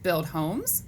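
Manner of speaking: animated, measured picture-book reading; read-aloud to children. Acted. Teacher reading animal facts to boys and girls, animated read-aloud. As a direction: read it out to young kids, lifting the questions.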